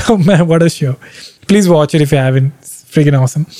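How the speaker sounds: ordinary talk, nothing marked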